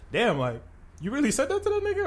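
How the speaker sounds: sudden high, fast squeak of disbelief; shocked disbelief. Retold or imitated. Teasing a friend about an insult he let slide, shocked squeal. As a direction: gasp it out like you can't believe your ears.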